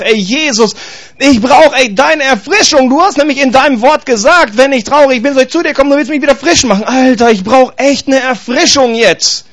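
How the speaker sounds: high-pitched, insistent acted plea; pleading. Preacher at home begging Jesus when worn out, pleading.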